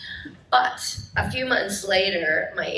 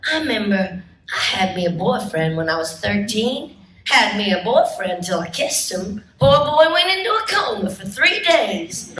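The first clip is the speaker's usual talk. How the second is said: sassy Southern Rogue; 6 dB louder than usual. Acted. Actress reliving her audition, sassy Southern accent. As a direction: play the Southern belle with attitude, Southern accent. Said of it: "husky voice who can do a Southern accent".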